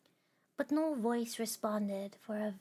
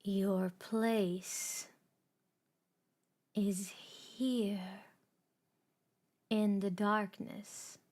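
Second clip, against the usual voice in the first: cold, bitter; much slower than usual. Acted. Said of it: "voice had turned cold, had turned bitter".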